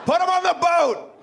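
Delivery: high-pitched, called-out command; mock shouted order. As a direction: banish him with a yell. Acted rejection after failed lesson.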